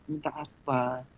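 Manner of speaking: low, hushed question; quietly approaching Lord. Retold or imitated. The Lord coming over to the woman, soft low question. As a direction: ask softly and low, close by. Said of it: "Dia bilang, disamperin".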